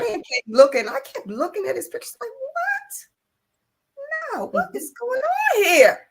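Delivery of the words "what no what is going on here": very high-pitched, incredulous squeal; baffled disbelief. Retold or imitated. Retelling her shock at his pictures, incredulous. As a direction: let the pitch soar in comic shock.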